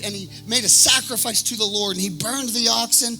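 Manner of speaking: high, urgent sermon delivery; ringing pulpit cry with stretched key words; impassioned preaching. Real, not acted. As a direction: build it like a fired-up preacher. Preaches at full force.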